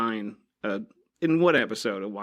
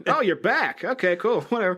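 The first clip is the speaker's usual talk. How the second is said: high-pitched, offhand greeting; Hypnos; 8 semitones higher than usual. Acted. Sleep god greeting the returning player, high and offhand. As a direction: play a higher-voiced, couldn't-care-less character. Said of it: "Don't forget Hypnos".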